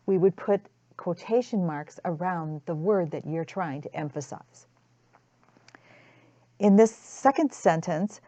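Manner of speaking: ordinary talk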